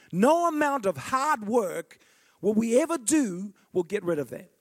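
ordinary talk

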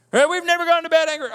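high, loud, rushed mimicry; hammed-up boast in a high voice; mock boasting. Mocking couples who boast of harmony.

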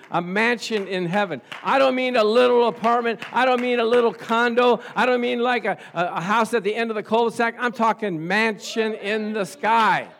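high, very loud sermon delivery; full-force preaching. Acted. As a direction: proclaim the promise to the whole congregation.

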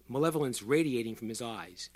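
plain reading voice

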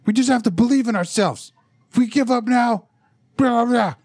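high, hammed-up heroic speech; mock the earnest leader rallying the team; pep talk hero. Friend mocking a cartoon hero's earnest pep talk, high hammy voice. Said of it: "He just starts spouting out all this generic platitudes".

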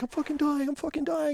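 high, small mock panic; scared baby mode. Tripper looping in fear, retold scared whimper. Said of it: "be so scared, like, baby mode".

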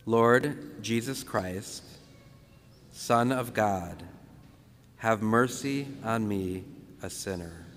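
slow, measured prayer, phrase by phrase. Shown as a demonstration. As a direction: leave room after each phrase for others to repeat. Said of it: "We'll say it very slow".

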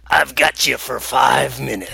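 gravelly wrestler growl; Macho Man voice. Retold or imitated. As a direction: rasp it like a wrestling promo. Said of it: "his just amazing gravelly v-, voice".

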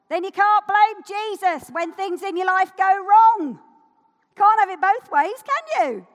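builds from firm to a loud, high preaching shout; rising shout. Real, not acted.

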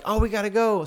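lightly acted hurry to escape; mock alarm.

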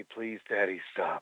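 low, soft sultry purr; a low seductive murmur. Pretend. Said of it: "Could you do that in your sultry voice?".

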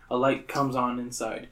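ordinary talk, nothing marked

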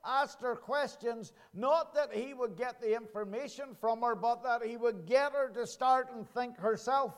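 rising, ringing pulpit preaching. Acted. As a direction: preach it out over the congregation. Preaching sermon.

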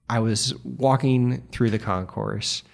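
ordinary talk

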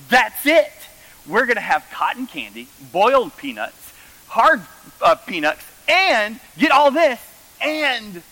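excited mom. Retold or imitated. Son mimicking his mom's circus-menu excitement. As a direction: bubble over with enthusiasm. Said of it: "She was like".

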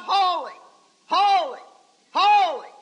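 loud, ringing cries of holy, each set apart; exultant, high preacher's shout; sounding off. Acted. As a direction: sound off like Isaiah newly in tune. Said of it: "he began to sound off".

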